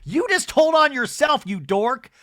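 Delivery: real, loud heated ranting. Real, not acted. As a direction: tear into her, worked up. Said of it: "I'm heated now".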